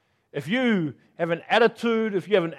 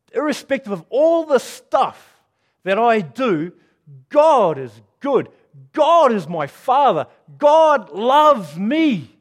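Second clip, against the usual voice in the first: preaching emphasis; 4 semitones higher, 6 dB louder, slower than usual. Real, not acted. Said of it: "emphatic preaching".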